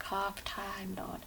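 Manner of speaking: "half Time Lord" whispered, soft and slow. Acted. Repeating the hybrid idea.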